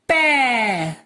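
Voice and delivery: पै held long, gliding down; drawn-out syllable. Shown as a demonstration. Teacher showing प with its matra, drawn-out पै. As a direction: stretch the vowel of पै. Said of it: "प में लगाई तो".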